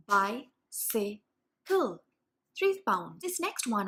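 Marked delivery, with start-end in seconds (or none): 0.1-2.0 bicycle said very slowly, syllable by syllable; split into syllables. Shown as a demonstration. Parent in the car breaking words into chunks for a child.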